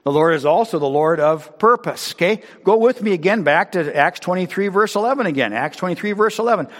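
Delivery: loud, high, driving sermon voice; full-force preaching. Acted. Pastor exhorting the congregation, loud and high. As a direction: preach it out to the whole church.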